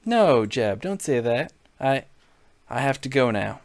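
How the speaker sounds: normal talking voice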